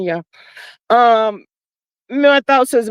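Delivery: ordinary talk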